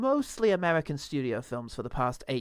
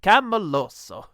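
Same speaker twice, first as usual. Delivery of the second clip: slow drawn-out loud catchphrase; quoted catchphrase; 2 semitones higher, 8 dB louder, slower than usual. Retold or imitated. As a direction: savour the phrase slowly. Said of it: "phrase I could remember from it was".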